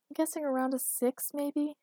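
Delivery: high, questioning tone; uncertain guess. A narrator's reading. Man guesses girlfriend's size for saleswoman, unsure. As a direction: offer it as a tentative guess.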